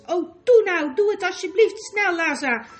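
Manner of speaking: high, loud, desperate begging; urgent pleading. A narrator's reading. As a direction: beg her to act at once. Begging friend to hide her. (dringend smekend)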